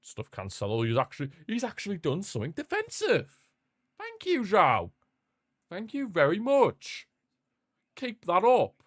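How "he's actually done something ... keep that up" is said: high mock praise.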